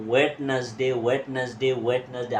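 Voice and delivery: "ওয়েডনেসডে" as wed-nas-day, three syllables; common mispronunciation. Shown as a demonstration. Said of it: "আসলে এটা কিন্তু ওয়েডনেসডে না".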